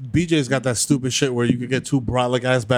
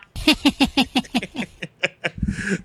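long laugh; 9 semitones higher, 3 dB quieter than usual. Real, not acted. Laughing at own prank.

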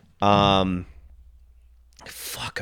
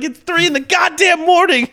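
high, loud exasperated shout; furious at being woken at night; heated yelling; 6 dB louder than usual. Retold or imitated. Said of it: "I got real heated with her".

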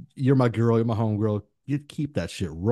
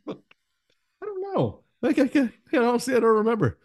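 laughing; 12 semitones higher than usual. Real, not acted. Laughing fit over forgotten topics.

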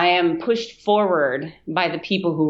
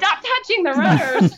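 mock yell; 9 semitones higher than usual. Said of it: "you gotta yell at her".

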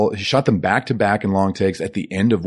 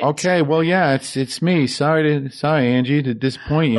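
higher, weary bank robber; lightly voiced Sonny, tired and sheepish; Sonny answering his wife's call, a bit higher; Sonny on the phone; 8 semitones higher than usual.

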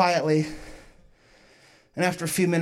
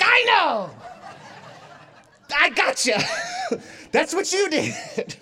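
gleeful gotcha; 8 semitones higher, slower than usual. Retold or imitated. Gloating gotcha at boyfriend.

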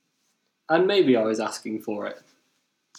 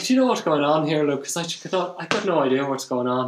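higher, quick Irish lilt; imitated Irish girl, put-on accent; 3 semitones higher, faster than usual. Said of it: "only Irish accent I can do".

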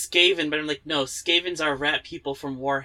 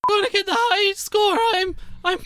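squawky cartoon character; 3 dB louder than usual. Player introducing Jibbity Flibbity, high and strained. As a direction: push it high and drag the words out.

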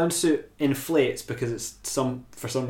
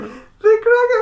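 very high, loud comic squawk; hammed-up title shout; 8 dB louder than usual. Host riffing on the show title, hammed-up squawk.

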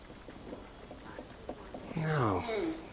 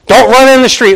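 put-on sharp, higher, louder warning to a child; stern warning; 9 semitones higher, 5 dB louder than usual. Parent warning a child away from the street, stern. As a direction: bark the warning at a kid. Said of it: "sometimes a little sternly".

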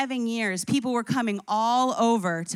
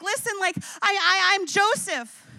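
crying out; 6 semitones higher than usual. Acted. Preacher voicing Joseph revealing himself, broken cry. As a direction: cry it out like a man breaking open. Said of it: "he's crying out in brokenness".

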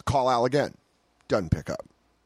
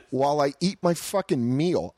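usual speaking voice

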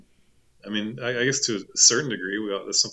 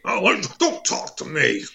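Alex Jones rant; 6 semitones higher than usual. Pretend. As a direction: spit it out like a furious radio host.

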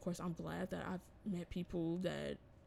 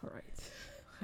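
whisper; 7 dB quieter than usual. Real, not acted. Cueing the next segment.

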